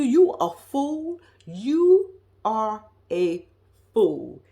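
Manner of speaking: each word of "you a fool" stretched out slowly; slow, drawn-out emphasis. Shown as a demonstration. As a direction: drag out "you are a fool" to hammer it home. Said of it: "when I tell you".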